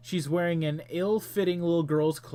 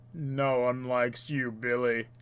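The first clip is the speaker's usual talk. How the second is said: put-on chat voice; 3 semitones lower, slower than usual. Reads player chat in put-on voice.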